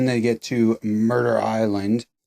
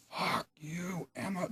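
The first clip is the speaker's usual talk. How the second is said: muttered aside; 8 semitones higher, 14 dB quieter, slower than usual. Retold or imitated. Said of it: "I'm like".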